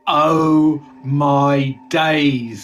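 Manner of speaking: loud, very slow drawn-out gasp; mock shock. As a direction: stretch it out in hammy disbelief.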